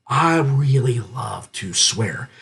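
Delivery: slow, savoring confession; low, slow gleeful admission; relishing. Real, not acted. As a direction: linger on it with relish.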